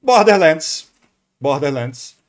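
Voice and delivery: ordinary talk, nothing marked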